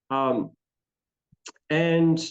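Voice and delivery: normal talking voice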